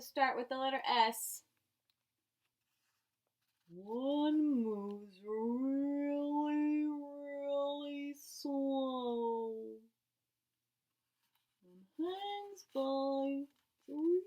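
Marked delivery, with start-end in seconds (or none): 3.7-14.2 very slow, drawn out and higher; very slow, higher voice acting out the sloth. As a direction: stretch every word like a sloth.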